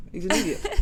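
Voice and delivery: says it again through a laugh; laughing at Khalid's foolishness. Real, not acted. Repeating "He's an idiot" to the co-host with a laugh.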